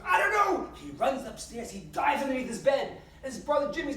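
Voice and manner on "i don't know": high, loud frantic cry; panicked boy. Acted. Billy answering the adamant preacher, panicked. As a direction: blurt it out as a cornered little boy.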